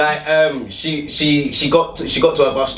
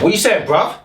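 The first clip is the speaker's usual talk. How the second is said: put-on higher, challenging London girl voice; girl's challenge; 6 semitones higher than usual. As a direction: voice the tough girl squaring up. Girl confronts him on bus.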